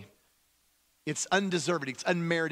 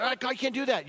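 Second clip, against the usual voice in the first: high mock objection; protesting theologians; 9 semitones higher, 3 dB louder than usual. Theologians objecting about God, high mock protest. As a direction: object in a raised pitch. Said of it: "There's a whole group of theologians".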